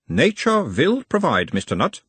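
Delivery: put-on high, probing analyst in Uberwald accent; Uberwald-accented therapist, pitched well up; mild Uberwald accent. A narrator's reading. Nutt as analyst asks about his mother, Uberwald accent. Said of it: "asked in a mild Uberwaldian accent".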